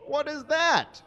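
high, hammed-up frightened shriek; mock scream. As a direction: squeal it like a startled girl. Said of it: "screamed Hermione".

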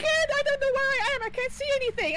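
frantic, lost in the dark; being chased. Real, not acted. Lost in dark during ghost hunt.

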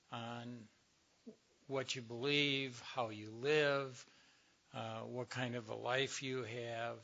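usual speaking voice

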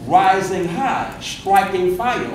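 drawn-out words, unhurried; a slow measured preacher's cadence; slow preaching pace. Shown as a demonstration. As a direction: savor each phrase slowly. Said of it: "they were going slow".

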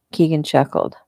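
ordinary delivery

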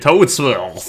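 usual speaking voice

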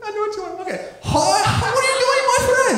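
mom's high, put-on Persian accent; high-pitched borrowed Persian accent; mock Persian accent. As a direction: greet the waiter in a high fake Persian accent. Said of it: "she will order in the accent of cuisine".

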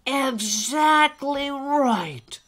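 loud, slow, emphatic encouragement; hearty old elf. Acted. Old elf offers Alistair a special job, hearty. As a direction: boom it out to build the little one up.

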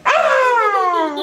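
high playful shriek of fright; mock scream. Mother pretending her child is so scary, playful scream. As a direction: scream as if startled, in play. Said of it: "You're so scary".

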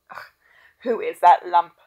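loud, recoiling disgust at a body. Acted. Doctor recoiling from a dead body, loud and disgusted. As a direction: spit out the ugh in revulsion.

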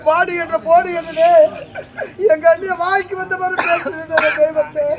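mock-weep with laughter leaking in; stage lament that tips into giggling; laughing while crying. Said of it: "ஏன் சிரிச்சுங்க அழுகுறீங்க?".

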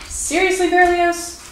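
high exasperated scolding; fed-up plea to the pet. Real, not acted. Scolding a cat mid-take.

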